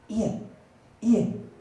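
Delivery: high, urgent call in mother's voice; mock whispering mother. Mother trying to get husband's attention on the phone, mock whispering. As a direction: imitate a mother calling, pitched high. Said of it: "I heard a lot of whispering".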